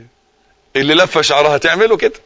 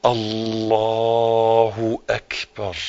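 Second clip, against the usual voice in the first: very slow, soft, stretched Allahu akbar; drawn-out takbir; 5 semitones lower, 5 dB quieter, much slower than usual. Shown as a demonstration. Preacher demonstrating the opening takbir, slow and stretched. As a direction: stretch الله اكبر out long and low. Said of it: "اتقن".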